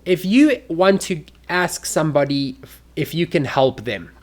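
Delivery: ordinary delivery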